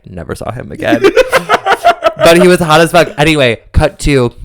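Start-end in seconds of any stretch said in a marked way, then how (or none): 1.1-2.1 squealing laugh; high laugh. Real, not acted. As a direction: burst into laughter. Laughs at punchline.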